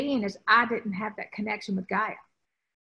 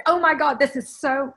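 excited delight; 3 semitones higher, 7 dB louder than usual. Retold or imitated. Client laughing in sand fight.

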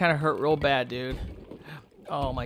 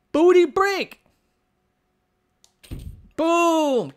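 very high, loud yell; squealed attack call; battle cry; 12 semitones higher, 5 dB louder, much slower than usual. Pretend. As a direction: shout your silly finishing move. Battle cry before a hit.

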